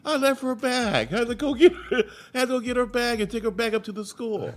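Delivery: wife explaining the forgotten bag; lightly voiced sheepish wife; wife's answer.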